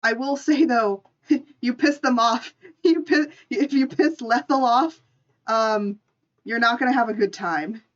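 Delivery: ordinary delivery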